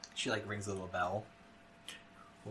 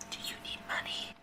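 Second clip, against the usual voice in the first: hushed secret question; whispering; 8 dB quieter than usual. Acted. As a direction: whisper it conspiratorially. Host leans in secretively.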